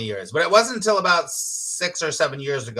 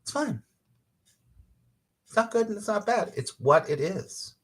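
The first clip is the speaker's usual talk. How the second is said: breezy acceptance; 6 semitones higher than usual. Retold or imitated. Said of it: "it's like".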